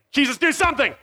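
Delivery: high, pleading mock prayer; desperate plea. The donkey sizing him up, desperate prayer. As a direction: beg heaven to strike the donkey.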